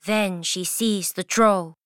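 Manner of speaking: ordinary delivery, nothing marked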